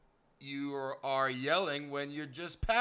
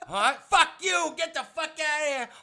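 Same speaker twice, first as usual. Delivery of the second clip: mock furious yell, much higher and louder; angry yell; 10 semitones higher, 6 dB louder than usual. [name] yelling at Kirk dressed as Dorothy, mock furious yell. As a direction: scream him out of the room.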